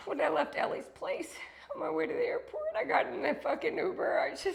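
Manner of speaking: high, choked-up voice close to crying; fighting back tears while saying goodbye; emotional farewell to viewers, tearful and breaking. Real, not acted. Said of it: "I'm losing my voice".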